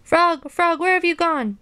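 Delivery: high-pitched, pleading search call; distressed calling. A narrator's reading. Toad spinning in the dark seeks Frog, distressed calling. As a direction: call high for a lost friend.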